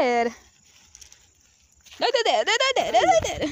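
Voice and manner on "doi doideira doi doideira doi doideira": high, loud playful stammer; excited sing-song. Real, not acted. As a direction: bounce the word up and down. Teasing the dog's excitement.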